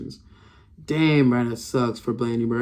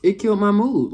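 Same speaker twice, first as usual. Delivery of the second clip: exasperated; 7 semitones higher, 3 dB louder than usual. Real, not acted. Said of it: "I promise you".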